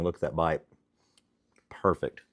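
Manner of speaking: talking through a mouthful; chewing while talking; mouth full. Real, not acted. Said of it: "talking with my mouth full".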